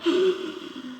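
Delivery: sharp high gasp of shock; crowd gasp. Retold or imitated. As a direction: imitate a crowd gasping. Crowd reacts to car.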